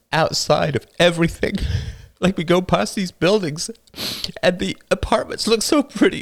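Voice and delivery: put-on a young boy fighting back sobs, laughing bitterly; tearful boy. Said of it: "The younger boy burst into tears".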